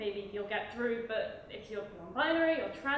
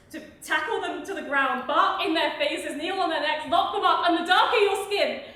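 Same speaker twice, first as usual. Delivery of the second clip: loud, raised pitch, hammering each item; furious outcry; 5 semitones higher, 11 dB louder than usual. Real, not acted. Poet accusing police of attacking protesters, in furious escalation. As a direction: build the list into a shouted accusation.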